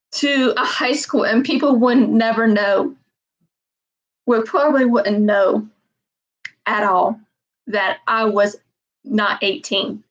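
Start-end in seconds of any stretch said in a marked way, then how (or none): none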